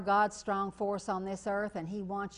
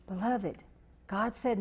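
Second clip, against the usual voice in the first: ordinary delivery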